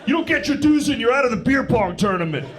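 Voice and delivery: very high-pitched, fussy frat officer; hammed-up high nagging college treasurer. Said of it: "I look like the treasurer of a frat".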